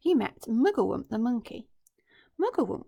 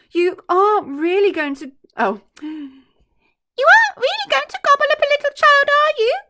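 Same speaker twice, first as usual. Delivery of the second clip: much higher, louder quavering plea; terrified monkey; 14 dB louder than usual. Acted. Shaking monkey pleading with the crocodile, high and frightened. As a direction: beg him not to.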